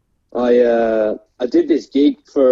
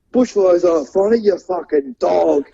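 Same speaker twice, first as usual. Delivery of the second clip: higher-pitched mock heckle, no real shout; imitated heckler; 5 semitones higher than usual. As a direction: drag out the insult like a heckler at the back. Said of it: "from the back of the room".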